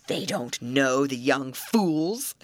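slow, mocking troll voice; sneering troll. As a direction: scoff like a troll.

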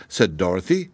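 ordinary delivery

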